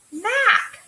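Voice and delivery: high, loud bright map; teacher finally getting it; quick discovery. Shown as a demonstration. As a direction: pop the word out in recognition. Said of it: "a little bit faster each time".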